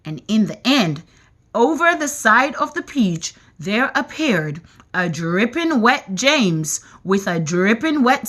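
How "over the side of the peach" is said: plain reading voice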